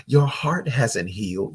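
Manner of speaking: ordinary talk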